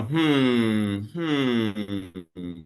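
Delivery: long drawn-out "hmm"; pondering hum. Shown as a demonstration. Teacher shows student how H M M sounds, long pondering hum. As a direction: hum it out thoughtfully. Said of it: "you're gonna go".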